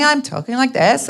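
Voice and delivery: playful American-accented singsong; mock LA intonation. Shown as a demonstration. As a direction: slip into an exaggerated LA accent. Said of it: "lean into the intonation and wherever I go".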